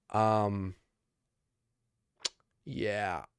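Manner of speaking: ordinary talk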